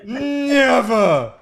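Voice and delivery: mock British outrage. Mock offended British character.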